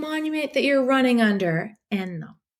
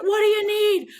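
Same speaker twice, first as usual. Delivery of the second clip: worried dad; 10 semitones higher than usual. Retold or imitated. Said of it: "He's like".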